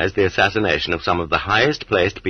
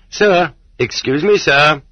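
a lightly voiced warder, higher and politely calling; 7 semitones higher, 4 dB louder, slower than usual. Warder calling to Andreev through the feeding hatch, polite and raised.

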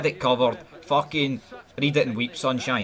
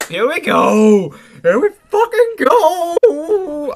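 long, drawn-out roar, much higher and louder; kickoff hype yell; 13 semitones higher, 10 dB louder, much slower than usual. Real, not acted. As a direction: belt it out like a fan as the match starts. Kickoff hype before the match.

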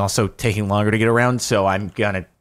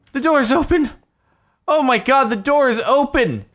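high, loud cry of disbelief; thrilled, high-pitched shout; astonished excitement; 13 semitones higher, 4 dB louder than usual. Real, not acted. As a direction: exclaim in amazed relief at finally getting through. Door finally opens after struggle.

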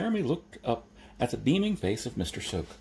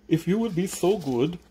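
lisping hunchbacked servant; eager lisping Igor; 9 semitones higher, 4 dB louder than usual. Acted. Igor's first words from crate.

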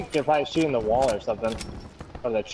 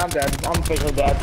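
usual speaking voice

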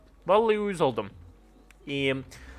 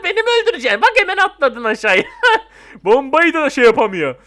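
high, loud, laughing disbelief; amused and raised voice; laughing protest; 5 semitones higher, 6 dB louder than usual. Real, not acted. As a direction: protest through real laughter. Laughing after a bomb attack.

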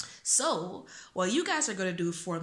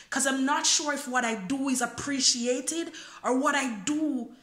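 spoken word poem; 4 semitones higher, 4 dB louder than usual. Acted. Poet reciting a freestyle poem to viewers, earnest spoken word. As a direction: perform the freestyle poem to your viewers, rising in feeling.

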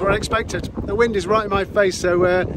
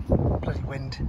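muttered under breath; 7 semitones lower, 6 dB quieter, slower than usual. Real, not acted. Annoyed at the wind.

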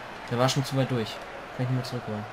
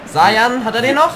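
higher and louder, urgent; excited call; 7 semitones higher, 9 dB louder than usual. Real, not acted. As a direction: call it out as the chance builds. Spannende Torszene im Spiel. (aufgeregter Ausruf, höher und lauter)

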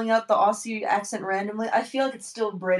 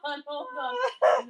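high, squeaky words through laughter; laughing almost to tears; helpless laughter; 7 semitones higher, much slower than usual. Real, not acted. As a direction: try to talk while cracking up. Laughing too hard to continue.

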